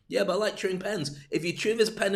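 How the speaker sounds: ordinary talk, nothing marked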